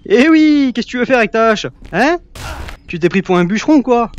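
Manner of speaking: high, loud mocking jeer; mocking taunt. Jeering at the axe-wielding enemy, high loud mockery. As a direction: poke fun at the lumberjack.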